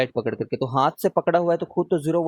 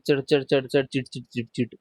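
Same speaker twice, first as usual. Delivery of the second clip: rapid-fire sputtering crackle, fading softer; imitated crackling; 2 semitones lower, 3 dB quieter than usual. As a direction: mimic air crackling with quick staccato syllables. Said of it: "फटने की कुछ आवाज रहती है".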